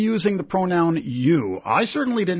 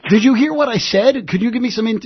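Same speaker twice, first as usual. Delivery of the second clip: loud, exasperated challenge; 4 semitones higher, 5 dB louder than usual. Real, not acted. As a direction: demand he actually listen. Host exasperated with non-listening caller.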